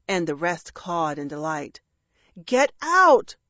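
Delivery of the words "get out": high, loud outburst; yell. A narrator's reading. Dragon to jeering crows, frustrated yell. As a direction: yell to chase them away. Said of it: "Gilbert yelled".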